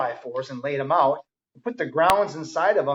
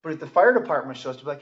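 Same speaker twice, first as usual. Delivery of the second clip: ordinary delivery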